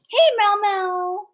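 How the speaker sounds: slow, high, stretched call; lilting slow call to Mel Mel; drawn-out call. Real, not acted. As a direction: stretch her name out slowly and high. Calling again, drawn out.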